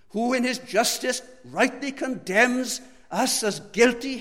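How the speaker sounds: rising preacher's fervor; full-force preaching. Acted. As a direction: drive it home from the pulpit.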